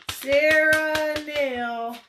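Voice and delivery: slow, high, loud sing-song of the name; proud drawn-out announcement; drawn-out excited call. Real, not acted. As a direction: stretch the name out in celebration. Announcing the winner.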